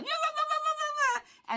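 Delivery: very high, rapid la-la yammering; mimicked dog racket. Dogs spot the dog across the street, high mock yammering. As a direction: imitate excited dogs in a high squeal. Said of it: "they're like".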